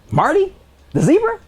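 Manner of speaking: high, disbelieving squeak of a question; incredulous. Retold or imitated. Teacher retorting to folks who compared him, high incredulous. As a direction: act amazed at the comparison, pitch up high.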